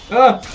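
loud, high groan of defeat; exasperated ugh; frustrated groan. Real, not acted. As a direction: groan as you die. Just died in game.

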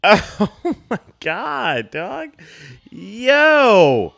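high, loud, drawn-out outcry; shocked exclamation. Real, not acted. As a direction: yell out in amazement, stretching the words. Shocked reaction to film scene.

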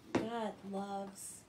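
plain reading voice

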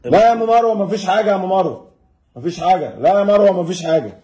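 high, falsely cheerful call to the wife. Acted. Father calls out to wife offstage.

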